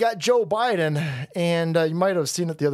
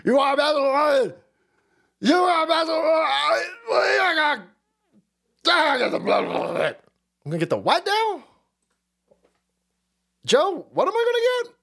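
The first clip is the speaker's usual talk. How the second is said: Biden impression; 10 semitones higher than usual. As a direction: shout it like a confused old man. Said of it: "he goes".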